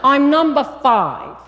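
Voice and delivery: put-on thick New York accent, slow and loud; Northern city accent. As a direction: play the brash Long Island girl. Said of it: "thick, big city Northern accent".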